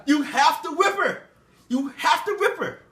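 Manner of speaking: high, loud tattling cry; shrill tattling mistress. Acted. Mistress shrieking to the master about Jenny, high and loud. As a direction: shriek it like a furious mistress telling on the girl.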